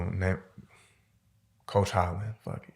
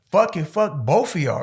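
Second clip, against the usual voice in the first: defiant; 9 semitones higher, 4 dB louder than usual. Retold or imitated. Storming off defiantly.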